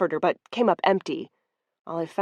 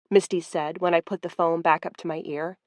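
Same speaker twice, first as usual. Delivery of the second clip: plain reading voice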